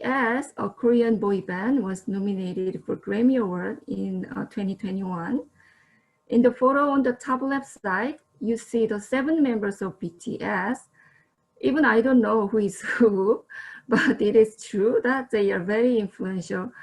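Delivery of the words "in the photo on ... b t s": ordinary talk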